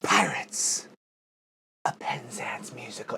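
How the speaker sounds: hushed breathy title whisper. Pretend. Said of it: "mock reverent whisper of title".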